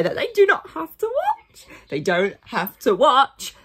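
stretched mocking rise on watch; sarcastic reading. Real, not acted. Mocking viewers who keep watching.

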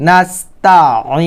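slow measured Quran recitation; recitação do Alcorão. Shown as a demonstration. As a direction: recite it slowly with held vowels.

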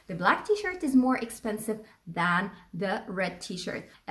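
slowed, even model sentence; steady classroom repetition; slow repeat. Shown as a demonstration.

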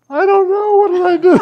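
high, loud flustered protest; imitating his own panicked answer; panicked reply. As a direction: blurt it out in alarm. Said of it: "I go".

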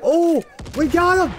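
very high, loud slow cheer; excited. Real, not acted. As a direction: cheer the hit. Celebrating a kill in game.

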